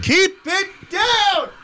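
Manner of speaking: high, slow yell; shouting. Acted. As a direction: bellow it at the noisy room. Yelling over family noise.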